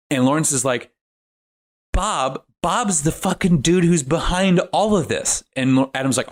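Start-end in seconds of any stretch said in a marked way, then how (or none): 1.9-5.4 high-pitched, indignant outburst; outraged realisation. Retold or imitated. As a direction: act out an exasperated revelation. Lawrence's outraged realisation acted out.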